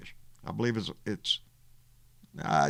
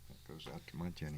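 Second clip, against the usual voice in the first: quiet; 7 semitones lower, 17 dB quieter, faster than usual. Real, not acted. Dedication aside.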